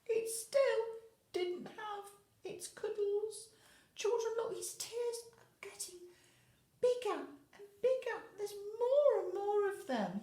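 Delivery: high, mock-sad voice for a crying creature; sad storytelling. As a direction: play up the sadness for the little ones, pitched high. Hoctopies crying, tears growing.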